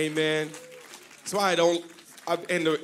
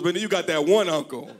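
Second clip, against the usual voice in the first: usual speaking voice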